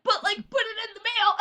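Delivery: high, fast exasperated explaining; wife explaining the obvious to husband, exasperated. Retold or imitated. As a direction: spell it out, fed up. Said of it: "I'm like".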